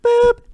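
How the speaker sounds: high, loud imitated intercom chime; intercom beep. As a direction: make a boop like a speaker system.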